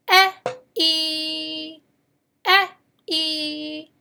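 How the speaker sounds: "E, E" as short clipped eh, then long held ee; two vowel sounds. Shown as a demonstration. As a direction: alternate eh and ee for "E, E, E, E". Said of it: "The letter E has two sounds".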